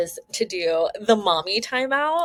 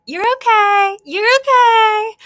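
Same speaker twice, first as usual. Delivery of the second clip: high, loud, insistent cheer-up call; over-reassuring parent; 13 dB louder, slower than usual. Acted. Parent rushing to a fallen kid, over-reassuring. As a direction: reassure too loudly, pitched way up, drawn out at the end. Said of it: "super well-intentioned, but they go".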